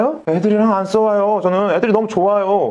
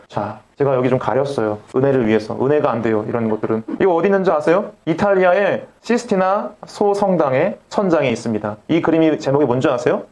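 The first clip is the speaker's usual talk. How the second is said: ordinary delivery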